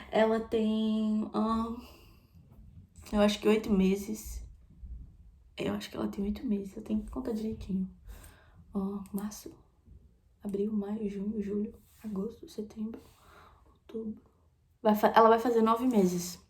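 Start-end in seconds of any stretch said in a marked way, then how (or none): 5.6-14.2 whispered, softer and softer, counting months; a softer-and-fading whispered count of the months; counting under breath. Real, not acted. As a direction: mutter the months to yourself.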